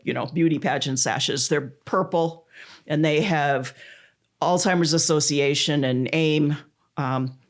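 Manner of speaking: ordinary talk, nothing marked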